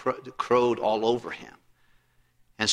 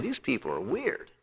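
muttered aside; 8 dB quieter than usual. Retold or imitated. Jailer muttering about singers.

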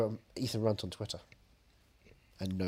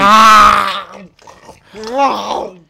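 dramatic scream; 5 semitones higher, 15 dB louder than usual. Acted. Dramatic scream over food.

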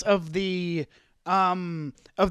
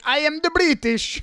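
mock British villain; 7 semitones higher than usual. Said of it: "'cause he sounds like".

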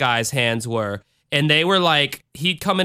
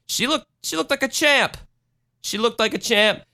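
excited; 9 semitones higher than usual. Real, not acted. Admiring a survivor.

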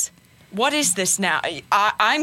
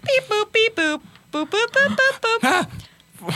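toy robot voice; 12 semitones higher, slower than usual. Acted. Calling on phone, robot beeps.

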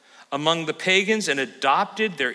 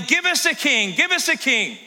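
whining; 9 semitones higher than usual. Retold or imitated. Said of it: "they start whining".

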